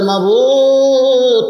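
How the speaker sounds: long, high sung wail; acted weeping invocation, stretched out; tearful cry to God. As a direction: call on the Lord in one drawn-out plaintive note. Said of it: "কান্দে আর বলে".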